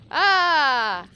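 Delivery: loud high yelp of fright; startled loud cry. Real, not acted. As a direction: cry out in shock. Sudden attack in game.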